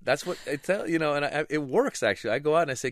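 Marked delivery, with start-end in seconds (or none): none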